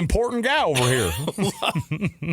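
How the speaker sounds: high, slow mock Southern voice; puffed-up and dopey Southern drawl; dumb Southern accent. Mocking a self-important guy, dumb Southern accent. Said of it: "They act like they're talking".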